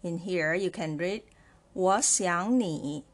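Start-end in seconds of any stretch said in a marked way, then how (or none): 1.7-3.0 我 and 想 rising, 你 in third tone; second-tone sandhi. Shown as a demonstration. Teacher showing learners how to read three third tones, rising on 我想. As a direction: read 我想你 slowly, lifting 我 and 想. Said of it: "should be pronounced as the second tone".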